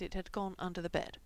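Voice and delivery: ordinary delivery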